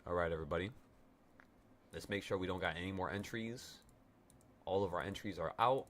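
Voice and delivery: ordinary talk, nothing marked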